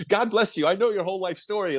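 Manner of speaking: gushing fan. Retold or imitated. Fan praising the doctor in the café, starstruck gushing. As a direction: pour it out like meeting your hero.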